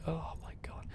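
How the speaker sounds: breathy exasperated whisper. Real, not acted. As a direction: whisper it under your breath. Exasperated aside about the game.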